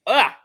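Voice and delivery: high loud pained gasp. Real, not acted. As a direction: let out a burning gasp. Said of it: "my back of my tongue just feels".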